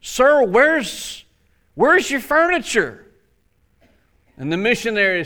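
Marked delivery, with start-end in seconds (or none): 0.0-3.0 high-pitched, puzzled question; taken-aback visitor. Acted. American visitor to missionary in bare house, acted surprise. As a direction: ask in acted bewilderment. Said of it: "as he's taken back a little bit".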